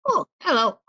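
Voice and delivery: high, tiny goblin greeting. Acted. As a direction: greet them like a small goblin. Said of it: "you hear a little goblin voice".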